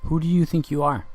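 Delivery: pointed high challenge; confrontational challenge. Retold or imitated. Challenging the woman who answered, pointed and high. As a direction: throw it at her as a challenge. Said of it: "what did she hear when I said".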